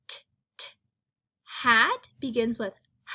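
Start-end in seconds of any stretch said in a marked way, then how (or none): 0.1-0.7 T, t as a crisp whispered t; isolated t sound. Shown as a demonstration. Teacher to class, isolating the final t sound. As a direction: give T, t as a clipped unvoiced t. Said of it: "what is the final sound".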